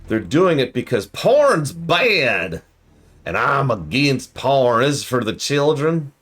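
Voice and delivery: mock Southern moralizer, dragging every word; Southern preacher parody for the children; weird southern accent. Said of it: "I'm going with this weird southern accent".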